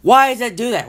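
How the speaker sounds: loud, high-pitched angry demand; exasperated outburst. Real, not acted. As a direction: cry out in sheer frustration. Furious rant about slow internet.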